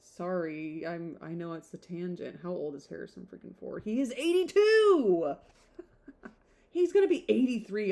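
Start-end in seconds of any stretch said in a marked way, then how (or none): 3.8-7.9 louder and higher, slowed in disbelief: He is eighty-two!; incredulous exclaim. Real, not acted. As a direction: punch out eighty-two in shock. Shocked at actor's age.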